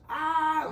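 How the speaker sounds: high, loud open "ah"; sudden high-pitched holler; acted yell. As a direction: cry out loudly, high and held. Acting out the photo pose.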